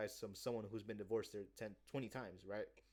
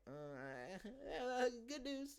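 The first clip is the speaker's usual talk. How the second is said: put-on high giggling girl voice; laughing put-on client voice; mock giggly client; much slower than usual. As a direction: giggle out the choice coyly.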